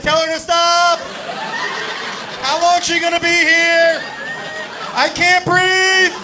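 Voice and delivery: high, loud strained plea; mock distressed yelling from under someone; squashed, loud complaining. Squashed buddy complaining in story.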